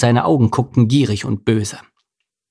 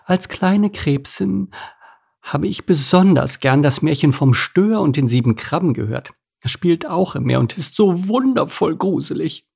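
put-on higher, dreamy old crab lady; a dreamy crab's voice, pitched up; crab Edith; 7 semitones higher than usual. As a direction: tell it like a fond old crab remembering.

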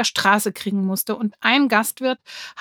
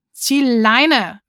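loud, pitched-up get-lost shout; sharp shout; 3 semitones higher, 6 dB louder than usual. A narrator's reading. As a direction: bark it at him to back off. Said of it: "rief Lulu". (lauter Ruf: Zieh Leine)

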